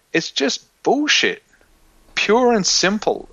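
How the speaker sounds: high, slow, exasperated dismissal. Real, not acted. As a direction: spit out the disgust.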